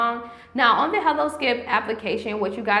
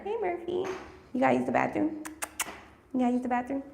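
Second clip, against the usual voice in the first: higher, sing-song pet talk; talking to the dog; 4 semitones higher, 6 dB quieter, faster than usual. Real, not acted. Host turns to her dog Murphy with sing-song pet talk. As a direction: coo at the dog about going out.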